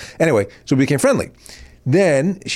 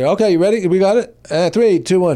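louder, higher mock countdown; stage manager count-in; 7 semitones higher, 3 dB louder than usual. Imitating the crew counting [name] in, louder mock countdown. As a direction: call the cue like a floor manager.

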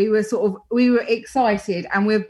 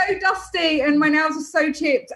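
higher pitch, giggling through it; laughing confession; 6 semitones higher than usual. Real, not acted. Wife admitting chipped nails to the host, laughing.

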